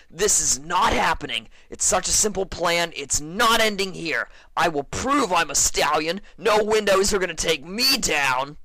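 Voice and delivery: high, loud desperate yelling; defiant shout. Acted. Stuck pegasus vowing to beat the window, shouting. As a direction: shout it like refusing to lose.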